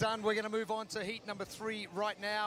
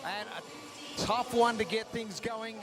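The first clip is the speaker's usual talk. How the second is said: loud live sports commentary; big on-air broadcast voice; broadcast commentator; 12 dB louder than usual. Acted.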